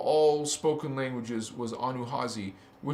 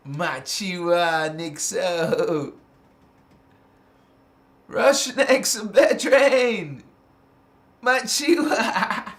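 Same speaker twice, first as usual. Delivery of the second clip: slow, whooping greeting that climbs; drawn-out calling out; 3 dB louder, much slower than usual. Real, not acted. Greeting viewers of the live, drawn-out jubilant calling. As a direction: call the names out long and jubilant.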